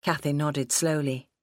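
plain reading voice

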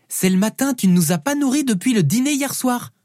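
put-on high-pitched little girl arguing; Felicity, protesting. Hungry daughter to her dad, high protesting child voice.

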